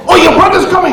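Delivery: sudden high yelp of warning; mock alarm. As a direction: blurt a hammed-up warning, pitched right up. Mock date interrupted by girl's brother.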